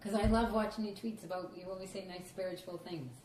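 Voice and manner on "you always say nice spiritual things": ordinary delivery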